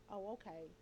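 low, very soft acknowledgement; subdued mock reply. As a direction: quietly imitate someone giving in. Acts out listeners' subdued reply.

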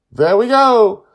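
high, loud little shout of triumph; gleeful cheer. A narrator's reading. Joy cheers as the shroom falls, loud and high. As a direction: celebrate the shroom dropping.

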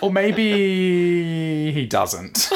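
high, very drawn-out "maybe"; teasing suggestion. Pretend. Co-host teasing that Goku may not know, high and drawn-out. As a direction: stretch "maybe" right out, pitched high.

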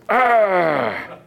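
long falling "ahh" of defeat; devil's frustrated cry. Acted. Devil losing his case storms out, frustrated cry. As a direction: cry out like a thwarted devil, pitch sliding down. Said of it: "To which the devil goes".

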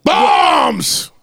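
shouted catchphrase. Acted. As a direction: bellow it out to the room. Calling out a catchphrase.

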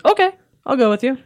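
high, loud perky yes; eager agreement. Retold or imitated. Flattered crewmate agreeing to join the hero, eager. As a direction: jump at it, pitched right up.

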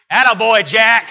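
imitating a loud, laughing high cheer; hammed-up proud dog owner cheering; mock cheer. Host laughing at the puppy, cheering his dog on with a loud mock cheer. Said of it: "Sartell starts laughing, goes".

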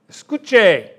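Spanish escuche said with Spanish vowels; Spanish word. Shown as a demonstration. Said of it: "She's listening".

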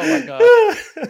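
speaking through big laughter; loud, breathless with laughter; laughing hard. Real, not acted. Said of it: "I laughed so hard".

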